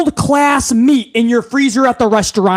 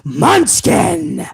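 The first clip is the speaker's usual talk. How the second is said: much lower, deep Munchkin; with bass in the voice; 7 semitones lower than usual. Pretend. Underling redoing Munchkin lower, as the boss demanded. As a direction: drop Munchkin low and heavy. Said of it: "put some bass in your voice".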